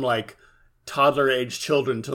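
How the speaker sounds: normal talking voice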